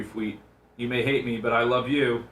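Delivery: ordinary talk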